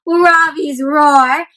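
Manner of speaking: usual speaking voice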